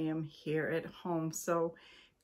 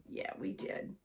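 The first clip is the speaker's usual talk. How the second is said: whispered aside; 8 dB quieter than usual. Real, not acted. Aside to viewers.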